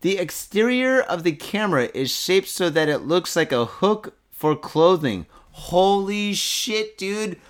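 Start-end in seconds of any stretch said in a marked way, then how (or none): 5.5-7.4 slow, drawn-out amazed holy shit; stunned holy shit. Real, not acted. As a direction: let the disbelief stretch the words. Reacting to the hook camera.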